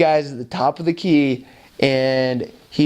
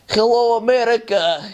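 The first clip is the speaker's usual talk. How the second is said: mock calling out; 9 semitones higher than usual. Guys on the court hail passing American missionaries, calling out. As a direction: imitate a teasing hail across the court.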